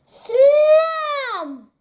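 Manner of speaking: a sudden high, loud door-slam sound. A narrator's reading. Narrator sounding out the badger's door slamming, loud.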